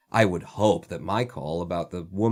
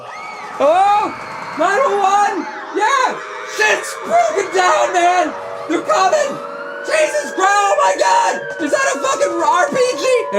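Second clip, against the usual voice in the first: shrieking, much higher and louder hammed-up panic; fake panicked 911 call; 7 dB louder than usual. Pretend caller overacting chaos to get police to come. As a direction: scream the emergency like it's a war zone. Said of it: "You call them up and you go".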